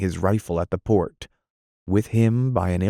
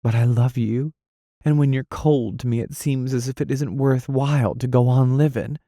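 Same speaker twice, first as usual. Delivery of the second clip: higher, tearful-sounding woman's plea; pleading lover; 5 semitones higher than usual. Acted. Dot confessing her love to Anthony, higher plaintive voice. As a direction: pour it out to him.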